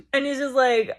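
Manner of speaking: ordinary talk, nothing marked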